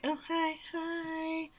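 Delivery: high, breathless little hi; startled girl, high and softly breathy; mock nervous greeting. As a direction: squeak out a nervous hello. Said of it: "they're like".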